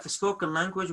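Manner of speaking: ordinary delivery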